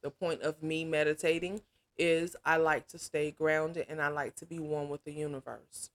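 ordinary talk, nothing marked

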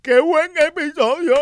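high, loud sarcastic fan cheer; mock cheer. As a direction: fake delight, slowed. Mocking applauding fans.

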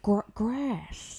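mock a Brit straining to say "grass" American; Michael Caine. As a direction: stumble into an overdone R on "grass". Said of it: "Yeah, he's like".

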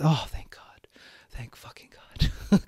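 breathy, hushed whisper of relief; relieved whisper. Pretend. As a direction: whisper it like a secret thank-you, slowing on the second line. Relieved about swearing allowed.

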